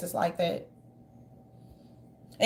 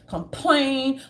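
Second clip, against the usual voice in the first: normal talking voice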